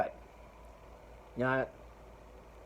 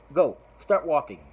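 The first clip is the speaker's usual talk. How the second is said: louder, sharp command to leave; loud order; 2 semitones higher, 7 dB louder than usual. Retold or imitated. Mike orders the petition guy away with a loud command. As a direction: shout him off the lot.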